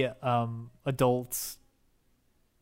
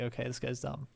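quiet inner aside; 9 dB quieter than usual. Retold or imitated. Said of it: "I was like".

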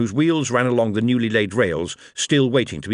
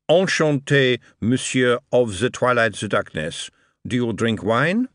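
put-on lightly French-accented host; a polite French aristocrat greeting; French Marquis; 2 semitones higher than usual.

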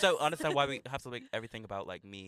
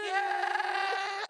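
a very high, strained sung yeah; mock diva wail. Belting a shrill high note at the listeners. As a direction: belt a screeching high note into the mic. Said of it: "sorry to all the listeners who are wearing headphones".

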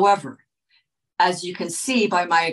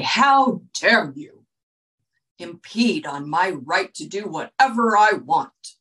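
mock offended outrage. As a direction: puff up like an offended child defending a right. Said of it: "become highly offended and angry".

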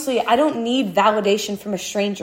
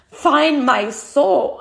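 emotional; 5 semitones higher, 4 dB louder, much slower than usual. Real, not acted. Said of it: "I'm getting so emotional".

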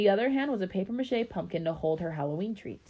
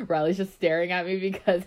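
real laugh bursting through, louder; breaking into laughter; 2 semitones higher, 6 dB louder than usual. Real, not acted. Reader cracks up at Riley staring at her, laughing. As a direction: lose it mid-aside and laugh.

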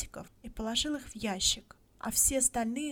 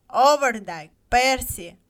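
loud call across the crowd; calling the next name loudly; 3 semitones higher, 13 dB louder, slower than usual. A narrator's reading. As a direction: shout the name to the square. Calling names at lottery.

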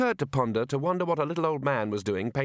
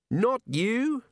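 snapped; 12 semitones higher than usual. Acted. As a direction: bite the answer off short. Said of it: "snapped the wizard".

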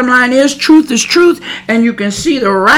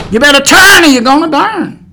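louder, higher preacher's cry; raised-voice preaching, urgent repeat; forceful warning; 5 semitones higher, 6 dB louder than usual. Real, not acted. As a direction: hammer the warning home at full voice.

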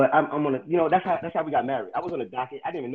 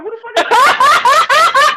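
high, loud, slow laughing yell; shocked yell; 17 dB louder, much slower than usual. Retold or imitated. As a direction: scream it in shocked disbelief. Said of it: "We was like".

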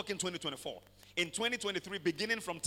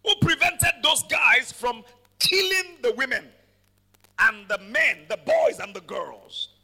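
loud, ringing sermon delivery; full-force preaching; 4 semitones higher, 10 dB louder than usual. Acted.

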